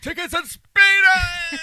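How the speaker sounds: shouted, drawn-out high movie impression; Jim Carrey. Quoting a favourite film line to co-host, manic high shout. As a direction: yell it high and stretch the last word. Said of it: "it's just Jim Carrey going".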